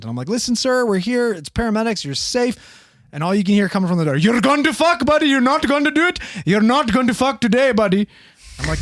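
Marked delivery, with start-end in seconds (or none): 0.3-2.6 higher, pleading calm; reassuring paramedic. Retold or imitated. Paramedic pleading with man behind door, raised reassurance. 4.2-8.1 mock Indian accent, higher and louder. Old man yelling through the door, mock Indian accent. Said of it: "he's got this really thick Indian accent".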